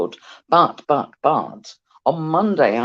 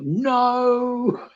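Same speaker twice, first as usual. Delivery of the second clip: knowing drawl; 8 semitones higher than usual. Real, not acted. Knowing punchline.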